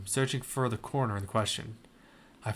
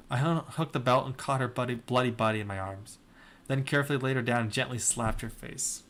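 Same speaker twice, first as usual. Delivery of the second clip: ordinary delivery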